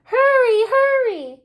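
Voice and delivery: high, loud urgent call of "hurry"; fire chief calling. Acted. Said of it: "called the fire chief".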